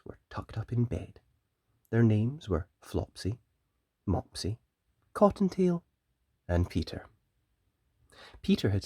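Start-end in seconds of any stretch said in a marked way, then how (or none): none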